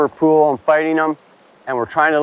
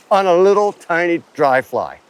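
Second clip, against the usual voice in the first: ordinary talk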